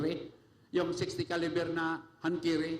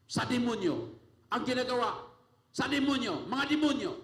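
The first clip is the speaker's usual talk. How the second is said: higher, building sermon fervor; rising preaching; 5 semitones higher than usual. Acted. Pastor telling the congregation about fearless preaching, building fervor. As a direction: climb with each phrase, preaching.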